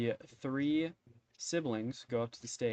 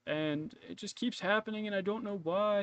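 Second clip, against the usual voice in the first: aggrieved cabbage seller; 7 semitones higher than usual. Acted. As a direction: plead your case in a put-on voice. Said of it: "you tune back in to hear a guy going".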